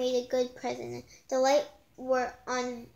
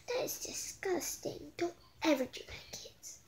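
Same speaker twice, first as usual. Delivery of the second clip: whispered aside; 4 semitones higher, 8 dB quieter than usual. Real, not acted. Reader whispering a warning to the kids listening.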